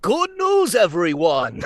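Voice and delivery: put-on high, quavering old professor voice; Professor Farnsworth. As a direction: squeak it out like a doddering old scientist. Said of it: "this sounds a little bit like Professor Farnsworth".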